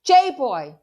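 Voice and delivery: loud, high call of his name; yelling. Acted. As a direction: shout his name after him. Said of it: "I'm yelling".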